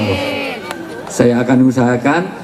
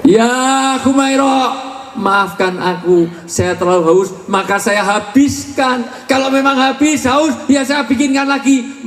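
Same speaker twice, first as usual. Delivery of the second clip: higher, slow and tender, calling her Humairo; tender address to wife; 9 semitones higher, slower than usual. Retold or imitated. Husband tenderly apologizing to his wife Humairo, higher and slow. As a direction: soothe her like a loving husband, slowly. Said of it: "Dimulai dari panggilan".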